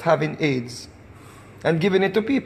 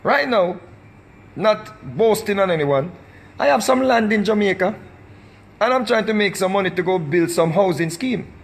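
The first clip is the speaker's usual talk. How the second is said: usual speaking voice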